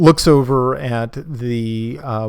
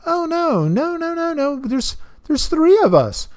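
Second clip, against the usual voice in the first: put-on much higher, eager girl's voice, tumbling out fast; excited young girl; 9 semitones higher, 3 dB louder than usual. Zathia rushing to tell the captain about her heroes, very high and eager. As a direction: chirp it out like an anxious child defending her friends. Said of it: "you hear Zathia go".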